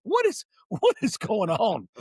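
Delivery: high, slow, laughing disbelief. Retold or imitated. Retelling the label deal offer, laughing in disbelief. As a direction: laugh it out, unable to believe it. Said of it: "And I went".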